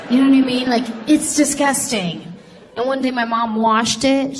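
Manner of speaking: ordinary delivery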